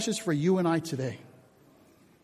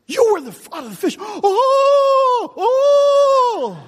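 crowd shrieking; 6 dB louder, much slower than usual. Acted. Preacher acts out crowd seeing Jonah.

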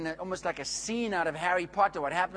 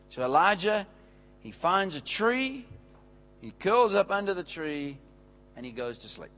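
ordinary delivery

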